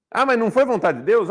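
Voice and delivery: "foi vontade de Deus" voiced as a mock question; mocking objector. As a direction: parrot the pious objection with a skeptical lilt. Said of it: "Ok, foi vontade de Deus".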